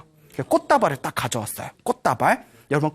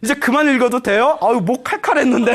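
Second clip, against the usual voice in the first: higher, comic monk glad of a break; jokey acted relief; relieved monk; 5 semitones higher, 4 dB louder than usual. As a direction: play the relief for laughs. Joking monks glad to stop chanting.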